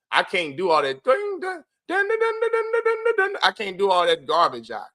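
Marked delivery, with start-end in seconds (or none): 1.0-3.4 high sung dun-dun-dun like a suspense soundtrack; mock dramatic sting. Streamer mocking his own remark with a high sung dramatic sting. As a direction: hum a cheesy dramatic sting, much higher.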